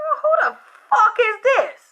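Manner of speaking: startled, demanding who's calling; shocked outburst. Retold or imitated. Woken caller shocked by a stranger's message, high disbelieving outburst. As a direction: pitch jumps way up on who in disbelief.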